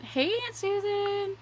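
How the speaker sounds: a light Canadian accent, high and slow. Shown as a demonstration. Demonstrating her Suddenly Canadian condition, a light Canadian accent. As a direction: greet Aunt Susan sounding lightly Canadian. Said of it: "say it with, like, a Canadian accent".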